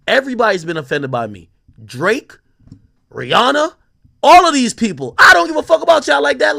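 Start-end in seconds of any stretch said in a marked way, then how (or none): 1.8-6.6 rising to a loud, high shout; building from firm to a loud, defiant yell; heated rant. Real, not acted. Ranting at offended celebrities.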